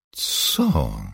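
puzzled, surprised question; astonished young woman. A narrator's reading. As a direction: ask it taken aback. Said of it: "spytała zdziwiona Melanie".

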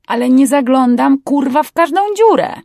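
high, loud, exasperated protest; indignant protest. Acted. Crewman defending himself to his boss, indignant. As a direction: push back loudly, voice climbing high.

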